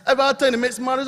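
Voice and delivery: ordinary talk, nothing marked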